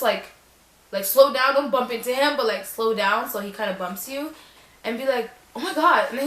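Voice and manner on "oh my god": mock surprise. Acted surprise on bump.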